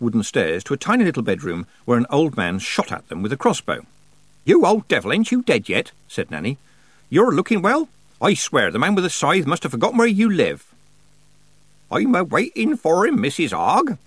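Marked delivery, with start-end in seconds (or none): 4.4-10.7 high, loud, teasing Nanny voice; hearty old witch. A narrator's reading. Nanny greets a bedridden old man, hearty and teasing. 11.9-14.0 put-on high-pitched, chirpy old countryman; cheerful old man. A narrator's reading. Old man banters back at Nanny, cheerfully. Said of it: "said the old man cheerfully".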